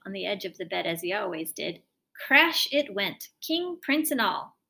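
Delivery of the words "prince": usual speaking voice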